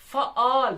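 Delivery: फआल broken as "fa-all", loud; teacher splitting फआल into two syllables; split into syllables. Shown as a demonstration. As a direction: say फआल in two separate beats. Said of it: "इसमें दो syllables है".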